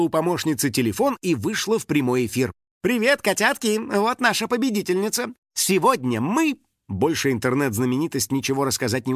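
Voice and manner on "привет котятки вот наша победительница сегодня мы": high, perky livestream greeting; blogger greeting. A narrator's reading. Star opening live stream for followers, perky. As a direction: hail the followers, pitch way up.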